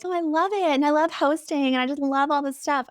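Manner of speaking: put-on high bubbly valley girl gush. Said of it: "I'm talking like a valley girl".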